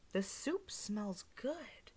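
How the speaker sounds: slow, soft whisper; old lady delighting at the smell. Acted. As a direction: savour it in a whisper. Old lady sniffs soup.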